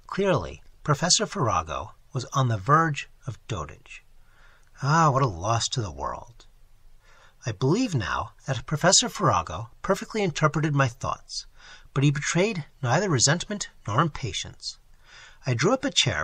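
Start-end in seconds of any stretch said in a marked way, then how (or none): none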